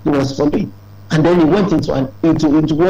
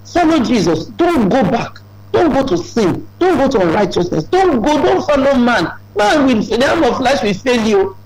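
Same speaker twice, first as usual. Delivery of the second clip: higher, pressing commands; preacher's urgent warnings; urgent preaching; 7 semitones higher than usual. Acted. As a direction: hammer each don't at the crowd. Repeated commands.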